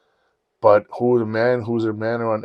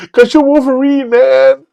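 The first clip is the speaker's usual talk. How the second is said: very high, loud indignant cry; shouted fan protest; 9 dB louder, slower than usual. Retold or imitated. Fan explaining the scream to the actor, shouted protest. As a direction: yell it up at the stage.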